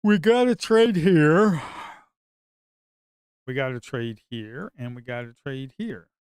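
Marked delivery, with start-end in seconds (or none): 0.0-1.6 higher, louder, stretched-out call; loud drawn-out urging. Real, not acted. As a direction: drag out a pleading shout. Urging himself to trade.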